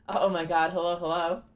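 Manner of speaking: ordinary delivery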